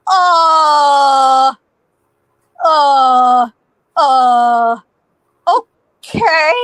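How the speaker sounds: long, loud drawn-out uhs; stunned hesitation. Retold or imitated. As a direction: stall in dumbfounded drawn-out sounds. Stunned after gunfight stops.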